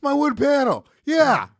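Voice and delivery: high-pitched playful shouting; mock outburst. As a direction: shout along in mock amazement. Mock outburst at co-host's summary.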